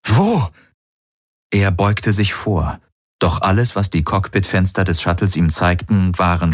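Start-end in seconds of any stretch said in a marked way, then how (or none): 0.1-0.5 startled, high-pitched question. A narrator's reading. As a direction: jolt out of thought with a quick high rise. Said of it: "Garland schreckte auf".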